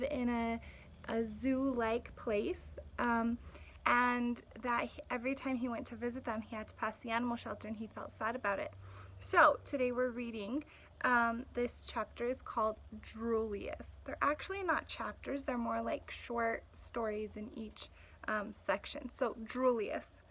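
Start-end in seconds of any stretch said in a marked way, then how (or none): none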